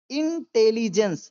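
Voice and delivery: intelligence drawn out slowly, in-te-li-jens; broken into parts. Shown as a demonstration. Teacher helping learners with big words, intelligence syllable by syllable. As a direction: split intelligence into slow separate syllables. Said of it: "उनको तोड़ के पढ़िए".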